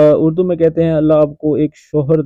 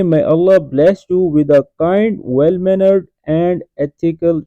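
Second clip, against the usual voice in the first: English said word by word, very slowly; very slow translation; much slower than usual. Shown as a demonstration. Teacher slowly reads the English translation to students. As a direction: drag out every English word for the learners. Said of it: "اور English میں بولیں گے".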